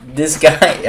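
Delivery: ordinary talk, nothing marked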